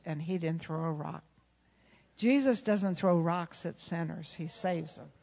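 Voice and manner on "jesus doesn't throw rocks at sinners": normal talking voice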